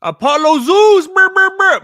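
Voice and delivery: high, loud hype call; hyped announcer shout. Acted. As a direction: shout the name like a ring announcer. Reads name aloud excitedly.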